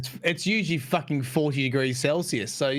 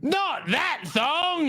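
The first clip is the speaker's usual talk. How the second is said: slow, drawn-out hammed-up Australian accent; 11 semitones higher, much slower than usual. In his own thong ad, a slow hammed-up Australian accent. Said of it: "in the best Australian accent I could do".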